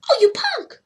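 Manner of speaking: high teasing pet voice; teasing the cat. Real, not acted. To the cat walking away, high fond teasing. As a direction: scold fondly.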